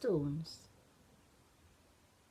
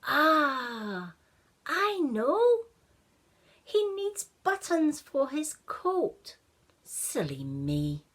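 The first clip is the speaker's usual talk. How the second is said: put-on much higher and louder, a delighted boy; excited idea; 8 semitones higher, 8 dB louder, slower than usual. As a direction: burst out with the answer, then a slow silly me. Ashton's bright idea.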